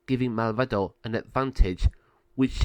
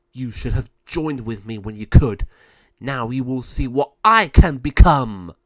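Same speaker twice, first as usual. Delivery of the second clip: louder and louder villain threat; menacing threat; 10 dB louder than usual. Acted. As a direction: build to a roaring promise of power. Malvado threatens Mariana.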